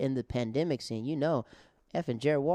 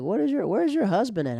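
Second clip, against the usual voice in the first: teasing jab; 8 semitones higher, 5 dB louder than usual. Retold or imitated. Retelling his teasing jab at heckler.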